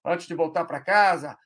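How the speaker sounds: exasperated parent scolding; mock scolding parent. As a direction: nag the kid hard. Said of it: "O cara pode tá brigando".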